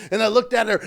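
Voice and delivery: ordinary talk, nothing marked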